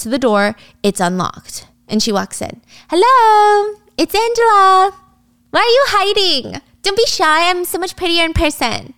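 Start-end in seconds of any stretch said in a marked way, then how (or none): 2.9-8.9 exaggerated flirty high-pitched voice; coquettish call-out. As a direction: call playfully to someone hiding. Angela calls out coyly for client.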